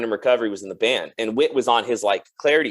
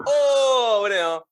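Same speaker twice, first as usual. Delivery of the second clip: shocked gasp; 8 semitones higher, much slower than usual. Retold or imitated. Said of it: "And we're like".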